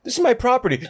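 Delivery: high, offended insistence; mock-indignant owner. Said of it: "He's like".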